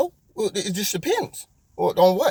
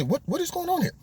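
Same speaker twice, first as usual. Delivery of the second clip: mock baffled question; 12 semitones higher, 5 dB quieter than usual. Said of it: "look at a situation like that and say".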